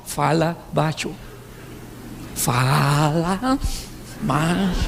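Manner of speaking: low, slow husband voice; marido imitado. Retold or imitated. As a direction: drag it out low, as a mock calm husband. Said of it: "seu marido fala com você assim". (marido falando grave e devagar)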